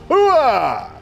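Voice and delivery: booming army "Hooah!" cry; loud gung-ho soldier. Real, not acted. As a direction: bellow it like a drill sergeant. Army battle cry sign-off.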